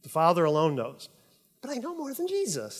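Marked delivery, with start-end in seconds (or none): 1.6-2.8 arrogant. Pretend. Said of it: "That's how arrogant we get".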